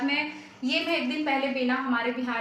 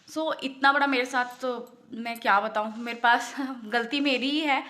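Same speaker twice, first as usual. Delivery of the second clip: usual speaking voice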